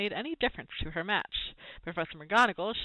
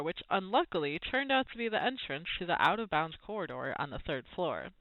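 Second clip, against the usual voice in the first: ordinary delivery, nothing marked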